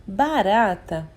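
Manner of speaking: put-on "barata" drawn out for a young reader; slowly spelling out "barata" for children; slow example word. Shown as a demonstration. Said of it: "Por exemplo".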